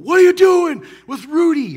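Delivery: high, loud furious yell; angry shout. Pretend. Farmer screaming at the men taking his donkey.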